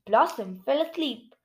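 ordinary delivery, nothing marked

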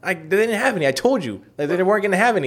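high-pitched defensive protest; retold exasperation. Answering brother's complaint about notebooks, retold exasperation. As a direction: argue back, acting out the squabble.

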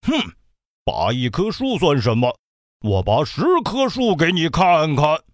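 a touch of huffy anger in the bear voice. Acted. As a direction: snort and boast back. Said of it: "大黑熊气得鼻子直喷气". (黑熊气呼呼地不服)

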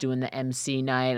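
ordinary talk, nothing marked

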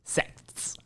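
a soft unvoiced whisper of the word. Shown as a demonstration. Friend spelling it out in a whisper. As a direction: breathe the word out with no voice. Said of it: "Se-, sext?".

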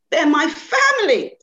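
loud, high, slow and proud; proudly naming his family. Acted. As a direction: declare it with pride. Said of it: "said Leo proudly".

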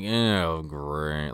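low, quiet, deflated reaction; unimpressed groan. Retold or imitated. Crowd hears of the wedding, unimpressed. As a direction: drop it low and soft, underwhelmed.

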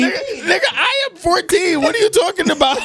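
put-on high, laughing, outraged teen; high panicked kid. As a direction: protest like a caught fourteen-year-old. Kid panicking at tuition call.

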